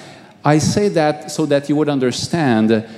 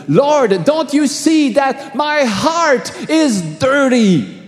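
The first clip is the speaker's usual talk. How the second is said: much higher, slow cry of panic; panicked shout; 11 semitones higher, slower than usual. Retold or imitated. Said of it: "and I shouted".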